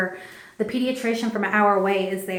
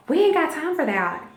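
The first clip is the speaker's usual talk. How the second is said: higher-pitched brisk refusal, imitated; urgent nurse; 7 semitones higher than usual. Nurse refusing an epidural mid-labor, urgent retort. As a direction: brush off the request as the nurse. Nurse snaps back at screaming mother.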